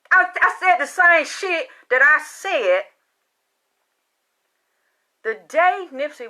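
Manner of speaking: loud, high-pitched, indignant outburst; heated insistence. Real, not acted. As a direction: raise your voice and insist in disbelief. Heated insistence she is unchanged.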